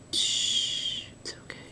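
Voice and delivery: very soft whispered shushing; soothing hush. Real, not acted. Artist soothing after a botched drawing, whispered hush. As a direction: hush and reassure, barely audible.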